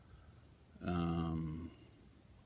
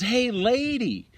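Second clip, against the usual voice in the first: calling out; 12 semitones higher, 4 dB louder, much slower than usual. Retold or imitated. Fred calling to cat owner.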